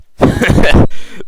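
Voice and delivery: a real, loud laugh that cracks up; loud burst of laughter. Real, not acted. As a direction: break out laughing at your own joke. Laughs at own joke.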